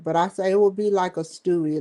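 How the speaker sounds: ordinary delivery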